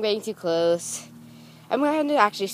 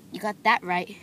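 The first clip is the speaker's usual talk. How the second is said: ordinary delivery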